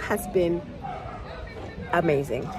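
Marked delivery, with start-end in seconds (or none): none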